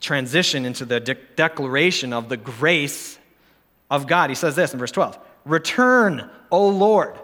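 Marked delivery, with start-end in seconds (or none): 5.5-7.2 pleading cry. Acted. Preacher voicing the psalmist pleading to God. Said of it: "He pleads out".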